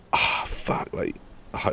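whispered curse under the breath; panicked whisper. Retold or imitated. Hiding upstairs while his friend lies, whispered panic. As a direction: hiss it so nobody hears you.

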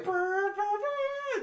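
high sung brr brr imitating a horn; mock horn blast. Describing the man who calls out quitters, mimicking the horn. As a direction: toot it out like a silly bugle.

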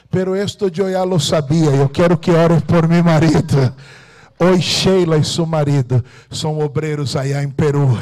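loud, high, triumphant shouting sermon; roaring pulpit praise; preaching at full force. Acted. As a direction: proclaim the victory to the whole church. Sermon climax, shouted testimony.

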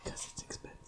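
deliberate hushed whisper. Pretend. Man explains why he whispers, in a whisper. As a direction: whisper the reason like a secret. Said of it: "Why are you whispering?".

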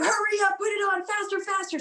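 high, urgent mock yell without volume; insistent high-pitched mock holler; imitated yelling. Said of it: "they're yelling at you".

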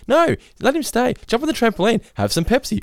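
high, doting grandparent voice; indulgent grandparent. Retold or imitated. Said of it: "and they say".

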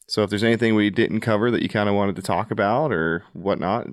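usual speaking voice

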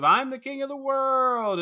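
high, drawn-out imitation of a film hero; hammed-up famous movie line; mock triumphant movie cry. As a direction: call it out high, stretching the words. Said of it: "the famous scene of".

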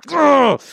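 loud, high-pitched "ugh"; disgusted groan. Real, not acted. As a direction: groan it out in exasperation. Disgust at consumerism.

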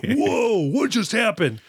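high-pitched, astonished "whoa"; mock amazement. Acting out startled reaction to the stab.

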